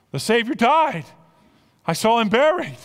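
ordinary talk, nothing marked

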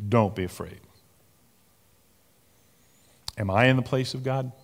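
tender reassurance. A narrator's reading. Joseph reassuring his frightened brothers, gently and tenderly. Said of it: "he spoke tenderly to them".

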